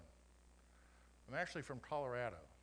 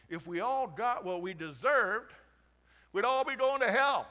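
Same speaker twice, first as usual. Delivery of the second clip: loud, high, emphatic preaching; preaching at full force; 6 semitones higher, 10 dB louder than usual. Acted. Pastor preaching grace to the congregation, loud and fervent. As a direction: hammer grace home to the crowd.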